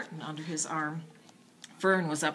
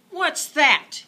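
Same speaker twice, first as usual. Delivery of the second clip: high, loud demand; 10 semitones higher, 12 dB louder than usual. A narrator's reading. Boy bursting into the kitchen, high and loud demand. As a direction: demand to know at once. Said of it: "he demanded".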